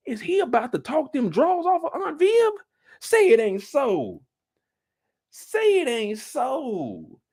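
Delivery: high, drawn-out mock dismay; scandalized disbelief. Commentator reacts to flirting with Aunt Viv, mock scandalized. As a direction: stretch it out in theatrical shock.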